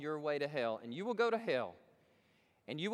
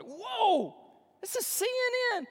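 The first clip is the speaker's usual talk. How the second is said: much higher pitched, loud disbelief; shocked; 7 dB louder, slower than usual. Retold or imitated. Preacher acting his shock at the radio, high and loud. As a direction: gasp it out in astonishment. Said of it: "I was like".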